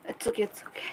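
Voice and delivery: hushed reassuring whisper; gentle whispered comfort; soft whisper. Real, not acted. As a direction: breathe "It's okay" almost soundlessly. Comforting a friend softly.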